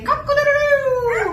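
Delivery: mock rooster crow. Shown as a demonstration. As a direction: crow it out for the bird to copy. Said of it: "let's do a rooster".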